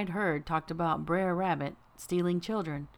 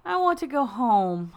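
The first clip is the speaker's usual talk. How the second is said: put-on high, slow, whimpering child voice; miserable little girl; 7 semitones higher, 6 dB louder than usual. A narrator's reading. Frightened girl begging to go home, high and miserable. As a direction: plead to go home, near tears. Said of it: "Ashley said miserably".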